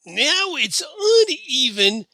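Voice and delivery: high, loud, drawn-out whine; whining. A narrator's reading. Mayor moaning about a shot-off mustache, loud high whining. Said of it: "Percy continued his whining".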